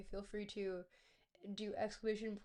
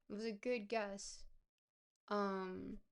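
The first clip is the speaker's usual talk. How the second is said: ordinary talk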